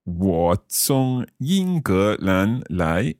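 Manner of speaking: very slow, syllable by syllable; slow learner's repeat. Shown as a demonstration. Learner repeating the teacher's Chinese phrase, very slowly. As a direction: draw out each tone carefully. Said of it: "I'll try repeating them".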